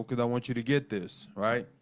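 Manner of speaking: ordinary talk, nothing marked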